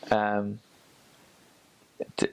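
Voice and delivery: usual speaking voice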